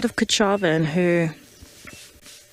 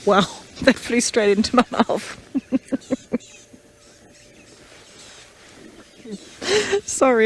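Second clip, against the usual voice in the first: laughing; 4 semitones higher than usual. Real, not acted. Bug flew into her mouth.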